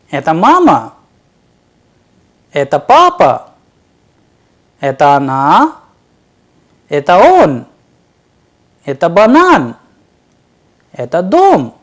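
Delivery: short questions with a sharp loud lift on the word after "Это"; question intonation. Shown as a demonstration. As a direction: ask each one, МАМА, ПАПА, ОНА, ОН, БАНАН, ДОМ shooting up in pitch. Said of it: "sentences are converted into interrogative".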